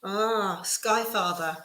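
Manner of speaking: normal talking voice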